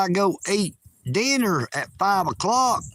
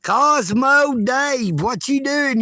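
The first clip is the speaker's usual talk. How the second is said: slow, louder and higher hail; calling out; 6 semitones higher, 4 dB louder, slower than usual. Real, not acted. Vlogger hailing a viewer, loud and drawn out. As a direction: call the name across the room.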